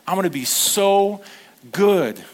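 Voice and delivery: so and good pushed loud and high; emphatic promise. Retold or imitated. Pastor voicing Jesus's promise, loudly stressing good. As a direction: lean hard into good.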